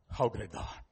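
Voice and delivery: low, very soft reverent voice; hushed awe. Shown as a demonstration. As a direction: say it softly, as if to yourself. Hymn line repeated, hushed.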